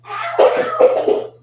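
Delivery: sharp high yelp of pain. Real, not acted. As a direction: yelp as it bites. Raccoon bites during play.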